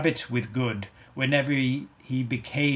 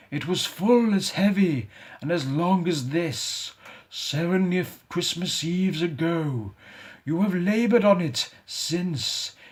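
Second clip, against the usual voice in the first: mournful ghost; 5 semitones higher than usual. Acted. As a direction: grieve for the wasted life. Ghost warning Good.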